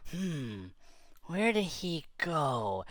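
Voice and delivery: soft, slow wondering to himself; puzzled musing. Retold or imitated. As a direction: wonder quietly, drawing it out. Puzzled, searching for lost monkey.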